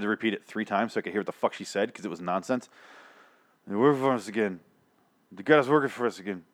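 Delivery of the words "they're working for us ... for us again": slurred, mumbled imitation; Maggie. Mocking the actress's nonsense line, sloppy slurring. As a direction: mumble it so the words blur. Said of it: "she's kinda slurring".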